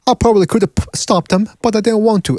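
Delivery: a hint of an English boxer's accent; mock English accent. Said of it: "after the fight goes".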